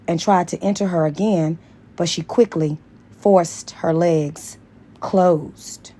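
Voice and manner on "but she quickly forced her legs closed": ordinary delivery, nothing marked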